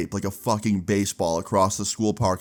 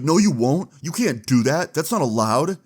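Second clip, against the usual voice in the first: indignant shout; 5 semitones higher, 3 dB louder than usual. Retold or imitated. Ethan protesting teacher.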